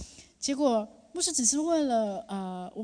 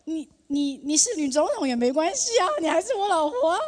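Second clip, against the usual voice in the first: imitating husband; 6 semitones higher, 7 dB louder than usual. Husband's playful reply quoted.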